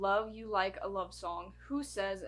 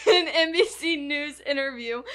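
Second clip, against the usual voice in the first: laughing while reading; 7 semitones higher, 10 dB louder than usual. Real, not acted. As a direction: read through laughter, higher and louder.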